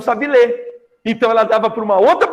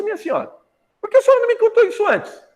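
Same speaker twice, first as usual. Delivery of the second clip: much higher, fast, astonished question; surprised disbelief; 14 semitones higher, faster than usual. Retold or imitated. Sebastião amazed at the lady's small secret, high astonished voice. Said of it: "o Sebastião olhou pra ela e falou assim".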